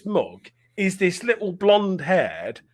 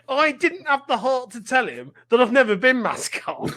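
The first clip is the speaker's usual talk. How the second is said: ordinary talk, nothing marked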